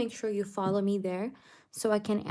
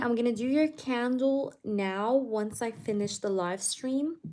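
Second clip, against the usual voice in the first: ordinary delivery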